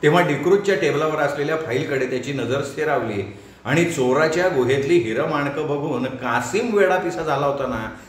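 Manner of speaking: plain reading voice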